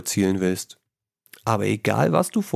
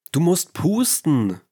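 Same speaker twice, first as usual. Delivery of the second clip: higher and louder, slower call of Du musst pusten; 7 semitones higher, 4 dB louder, slower than usual. A narrator's reading. Narrator reading the story, the man calling out to her. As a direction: read his call out with a lifted, slow voice. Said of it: "rief er".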